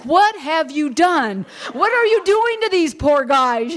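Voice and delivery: high, slow mock-scolding; exasperated husband. Husband finds drunks lifting her car, slow exasperated mock scold. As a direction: imitate a husband's disbelieving scold.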